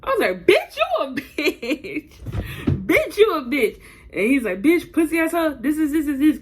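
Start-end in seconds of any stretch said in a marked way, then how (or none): 0.5-3.8 high, drawn-out taunt with a laugh; mocking insult. Acted quarrel, insult thrown back. 4.6-6.4 higher-pitched mock tirade; retold cursing rant. Acts out his cursing rant.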